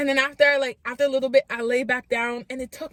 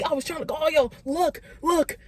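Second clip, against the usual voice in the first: insisting; 6 semitones higher than usual. Retold or imitated. Insisting parents see call log.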